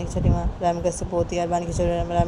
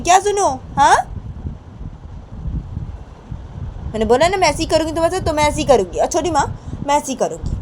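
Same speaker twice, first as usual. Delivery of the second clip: high, raised retorts, dipping midway; angry, defiant Rama; 8 semitones higher, 3 dB louder than usual. Acted. Rama refusing to listen to Chhoti Maa, angry defiance. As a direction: throw the scolding back, insistent.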